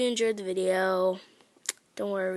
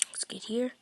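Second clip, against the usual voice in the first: soft unvoiced whisper; 7 dB quieter than usual. Real, not acted. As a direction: whisper it under your breath. Quiet start of game run.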